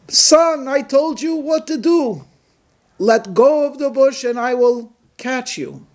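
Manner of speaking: high, insistent voice of God; God's voice. Acted. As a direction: repeat the command as God, firmly. God voice repeats command.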